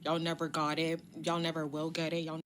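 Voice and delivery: usual speaking voice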